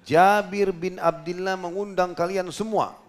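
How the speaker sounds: loud, high, slow proclamation; retold shout. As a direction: call out to a whole crowd, stretching the words. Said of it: "lalu beliau teriak". (menirukan seruan lantang ke orang banyak)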